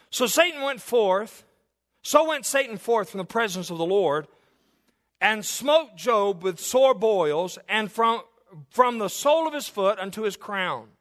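preacher intoning from the Bible; Scripture reading. Acted. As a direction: read the verse like it carries weight. Preacher reads Scripture aloud.

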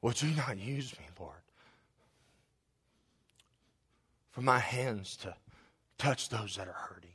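spoken prayer that swells to a pleading climax, then drops to a hush; preacher praying over his church; fervent prayer. Acted. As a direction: pray aloud with rising fervour, then soften. Pastor prays aloud before congregation.

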